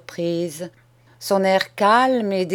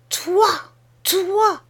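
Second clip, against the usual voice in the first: much higher, shocked exclamation; stunned cry; 12 semitones higher than usual. Acted. Master stunned by the boy's confession, crying out in shock. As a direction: gasp it out in disbelief. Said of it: "s'écria monsieur Holdnick ... une stupéfaction profonde".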